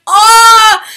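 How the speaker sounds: high, loud excited scream; crowd scream. Retold or imitated. Everyone hears the news, excited loud scream. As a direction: imitate friends shrieking at big news.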